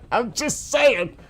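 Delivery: high, slow, drawn-out playful tone; playful disclaimer. Real, not acted. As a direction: stretch it out high, just after a laugh. Jokes after his warning, still amused.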